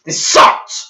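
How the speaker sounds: loud, exasperated shout; angry outburst. Real, not acted. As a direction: blast it out in real disgust. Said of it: "I'm sorry I'm getting angry".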